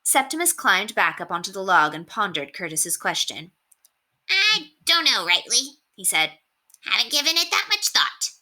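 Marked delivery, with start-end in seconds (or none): 4.3-8.3 a small rat thinking aloud; high rat voice, musing. A narrator's reading. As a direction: rat pondering his own plans. Rat character voice.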